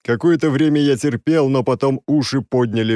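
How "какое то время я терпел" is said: plain reading voice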